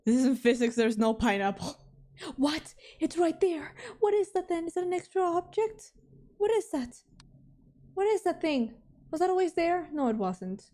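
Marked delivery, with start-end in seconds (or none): 2.3-9.9 high-pitched, baffled questioning; startled and puzzled, pitch well up; alarmed disbelief. Real, not acted. Spots an unexplained object.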